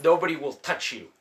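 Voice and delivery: ordinary talk, nothing marked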